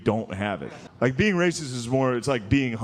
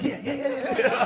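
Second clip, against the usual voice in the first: 1 eager yes; 11 semitones higher than usual. Retold or imitated.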